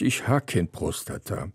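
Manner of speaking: ordinary talk, nothing marked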